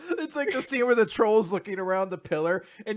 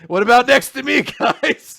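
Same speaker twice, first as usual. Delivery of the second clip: eager; 3 semitones higher, 10 dB louder than usual. Retold or imitated. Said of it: "He's like".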